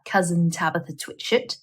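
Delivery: usual speaking voice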